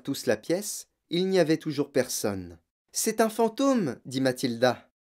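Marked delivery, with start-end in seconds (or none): 2.9-4.0 high, slow, spooked announcement; awed alarm. A narrator's reading. Matilda play-acting to scare her family, spooked. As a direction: draw it out on a high pitch. Matilda feigns fear of ghost.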